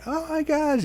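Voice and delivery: very high, soft mock gasp of embarrassment; imitating a mortified date in falsetto; imagined embarrassed reaction. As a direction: squeal it softly, way up high. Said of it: "be like".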